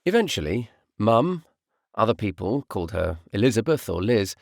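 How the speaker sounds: ordinary delivery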